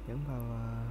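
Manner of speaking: ordinary talk, nothing marked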